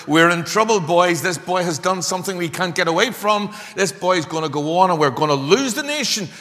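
loud, high, urgent acted alarm; rally the others in mock panic; alarmed council member. Sanhedrin member warning the boys of trouble, alarmed.

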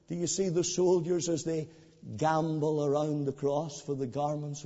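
soft, low, intimate preaching; preacher pleading gently with an unconverted friend; hushed invitation. Acted. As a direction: draw the listener close, quietly. Inviting listeners to the cross.

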